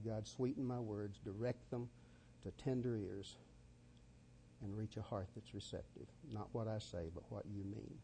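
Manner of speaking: soft, hushed prayer to God; quiet prayer. Real, not acted. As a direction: pray it gently, just above a murmur. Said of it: "Let me say a, a prayer to God".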